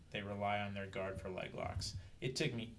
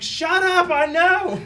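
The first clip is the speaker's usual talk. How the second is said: much higher and louder, snapped back; exasperated shout; 19 dB louder, much slower than usual. Retold or imitated. Fighter yelling back at his coach, exasperated high shout. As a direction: yell back mid-fight, fed up. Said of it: "I'm like".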